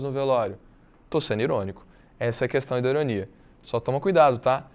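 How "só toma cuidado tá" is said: ordinary talk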